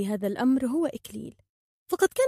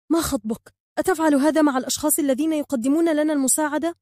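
loud, raised indignant rebuke; outraged reprimand; angry scolding; 5 semitones higher, 6 dB louder than usual. A narrator's reading. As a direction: tell him off sharply. Asef scolds the phoenix.

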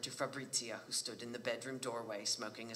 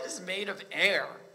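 loud, raised-pitch scornful retort; scoffing; 6 semitones higher, 8 dB louder than usual. A narrator's reading. Dairyman rejects the appeal to fairness, scoffing. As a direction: scoff it out loudly. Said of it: "the dairyman scoffed".

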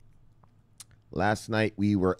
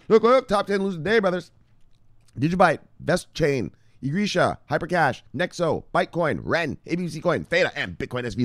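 hype show host; 7 semitones higher than usual. Acted. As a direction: pump up the brothers like a show opener.

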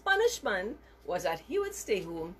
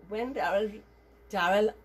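ordinary delivery, nothing marked

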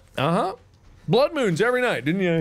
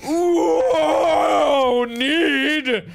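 loud, high whoa; excited shout; 6 dB louder than usual. Acted. Co-player reacting to steroid joke, excited high shout. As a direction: yell it out in shock. Sees friend injecting steroids.

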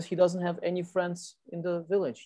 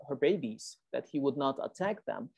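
ordinary delivery

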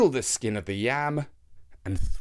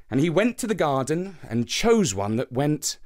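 usual speaking voice

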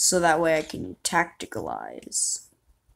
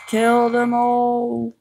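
slow, loud, higher command; battle order; 6 semitones higher, 7 dB louder, much slower than usual. Pretend. Commanding troops with a slow loud order. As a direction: bellow the order like a warlord.

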